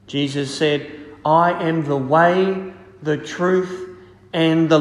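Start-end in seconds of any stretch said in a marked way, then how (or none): none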